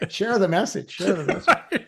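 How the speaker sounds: a joking preacher's line through giggles; laughing. Real, not acted. Guest teasing host about being Saint Bill, laughing.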